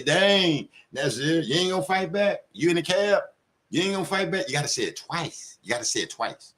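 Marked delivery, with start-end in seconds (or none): 0.0-4.5 mock taunt. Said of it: "That way you can say".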